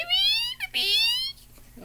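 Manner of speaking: very high thin whistled "wee"; goldfinch call. Shown as a demonstration. Bird caller imitates goldfinches at feeders, high thin wee. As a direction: chirp wee like a small finch. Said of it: "'cause they go wee".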